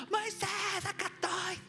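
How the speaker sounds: high, eager call from below; wave-me-down call in a comic high voice; Pedro. Acted. Disciple below to Moses, high eager calling. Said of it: "cuando miran abajo está Pedro".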